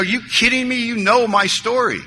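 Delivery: mock outraged protest; retold yell. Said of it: "I yelled at my sponsor".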